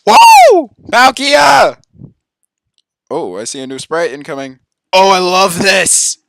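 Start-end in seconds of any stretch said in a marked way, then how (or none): 0.1-1.8 high loud whoop; loud excited shout of the name; cheering. Real, not acted. 4.9-6.2 slow loud gleeful cry; loud thrilled exclamation; delighted. Real, not acted. Excited delight.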